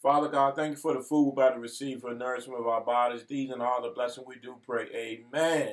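quick murmured mealtime grace; prayer. Acted. Grace before the meal.

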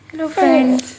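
high, sweet "hello friends" voiced for the baby; cute baby hello. Mother greets viewers on her baby's behalf in a high cute voice. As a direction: speak the greeting as the baby would. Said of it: "प्यारा सा हाय देख लिया".

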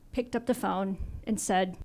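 normal talking voice

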